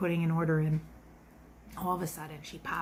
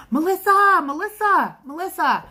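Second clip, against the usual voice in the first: loud call, fading each time; shouting after someone leaving; calling out a name; 9 semitones higher, 10 dB louder than usual. Retold or imitated. Calling out to customer across restaurant.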